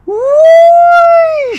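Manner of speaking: very high, very loud shriek; shrieking whoosh. Acted. The chicken shooting into the air with a shriek. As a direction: let out a piercing whoosh. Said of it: "shrieked the chicken".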